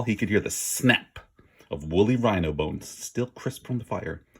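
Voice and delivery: usual speaking voice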